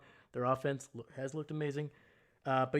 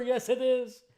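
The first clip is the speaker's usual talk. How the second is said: much higher and louder exclaim; excited agreement; 10 semitones higher, 6 dB louder than usual. Real, not acted. Streamer cheering with chat that football is back, excited.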